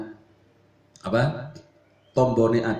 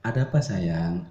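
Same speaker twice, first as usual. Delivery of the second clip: lower, gentle sweetheart reply; soft wife's reply; 7 semitones lower than usual. Acted. Wife calming her angry husband, lower gentle reply. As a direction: soothe him softly. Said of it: "suami yang keras itu kamu lembutin aja".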